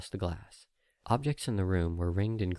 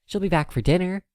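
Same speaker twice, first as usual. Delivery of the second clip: high, bright little filly voice; a small foal full of trust; little filly proudly pointing at the sky; happy filly; 11 semitones higher, 8 dB louder than usual. Acted.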